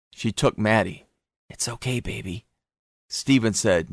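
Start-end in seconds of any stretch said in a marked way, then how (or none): 1.5-2.5 put-on soft hush to the baby; calm the little one quietly; soothing. A narrator's reading. Lorelei comforts the frightened baby, soft and hushed.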